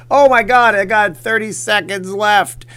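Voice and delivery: loud, high burst of surprise; real sudden urgency; alarmed exclamation. Real, not acted. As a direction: blurt it out high and loud, time nearly gone. Sudden notice that time runs out.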